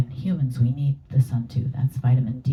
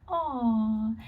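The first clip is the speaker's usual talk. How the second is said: tender aw; 8 semitones higher, 4 dB quieter than usual. Retold or imitated. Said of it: "like it's that".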